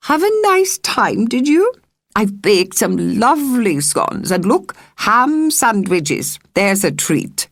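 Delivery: put-on a cosy country landlady greeting the girl, high and fussing; Missus Lockett. A narrator's reading. Kindly landlady voice.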